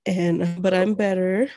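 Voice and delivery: ordinary talk, nothing marked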